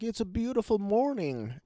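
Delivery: high, lilting mock cheer; hammed-up morning glee; happy Grinch. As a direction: play a blissfully chirpy riser. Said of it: "he's happy in the morning".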